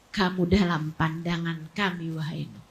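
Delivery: low, very soft solemn address; hushed reassurance. Acted. God telling Nuh he is watched over, low and hushed. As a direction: let it sink down almost to nothing.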